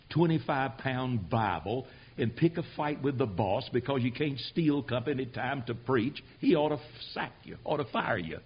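ordinary talk, nothing marked

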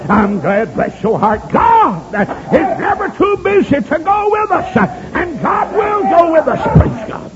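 high, drawn-out preacher's cry; fervent preaching. Real, not acted. Preacher assuring the congregation God goes along, fervent preaching. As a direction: preach it slow and high, stretching the words.

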